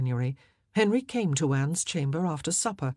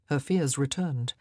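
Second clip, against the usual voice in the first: ordinary delivery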